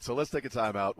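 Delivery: ordinary talk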